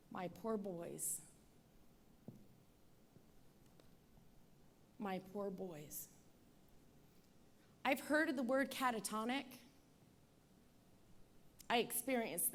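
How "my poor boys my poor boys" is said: soft, low, slow lament; mournful murmur. Retold or imitated. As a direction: murmur it like a grieving mother. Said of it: "I remember myself saying".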